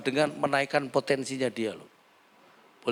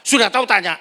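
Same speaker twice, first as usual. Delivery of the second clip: loud, higher irritated snap; mock snapping husband; 8 semitones higher, 10 dB louder, faster than usual. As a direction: bite back at the wife's question. Husband snaps at wife. (suami membentak istri yang bertanya)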